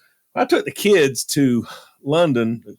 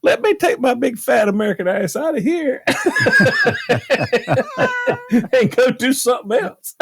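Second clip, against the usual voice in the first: laughing through it; 11 semitones higher than usual. Retold or imitated. Telling the snooty saleslady where to go, laughing. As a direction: retort half-laughed, rising high.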